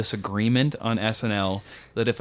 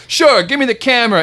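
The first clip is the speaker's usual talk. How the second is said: high, loud, mock-obliging; big, eager fake helpfulness; Andrew Dice Clay; 10 semitones higher, 5 dB louder than usual. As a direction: imitate a comic humoring a fan. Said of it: "take pictures with Andrew Dice Clay".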